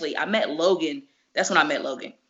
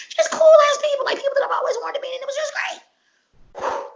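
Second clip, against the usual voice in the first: much higher, loud crying-out squeal; real overexcited shout; overjoyed squeal; 4 dB louder than usual. Real, not acted. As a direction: let the joy burst out high. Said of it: "You get that out?".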